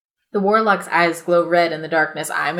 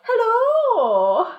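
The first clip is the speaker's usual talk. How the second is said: high called hello; calling out. Acted. The player calls out to the warlock, high and drawn. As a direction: call across to the campfire.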